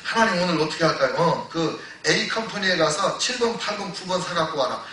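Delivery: ordinary delivery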